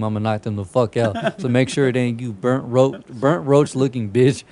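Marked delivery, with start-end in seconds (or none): none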